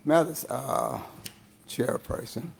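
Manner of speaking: hoarse, fading voice; rough, worn-out voice. Real, not acted. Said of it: "I'm losing my voice".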